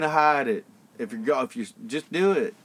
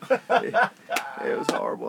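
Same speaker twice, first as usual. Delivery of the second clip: Bill Clinton voice; 5 dB quieter than usual. Pretend. Friend urges him on, so he keeps the Clinton voice. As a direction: sink deep into a husky Clinton confession. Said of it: "keep going".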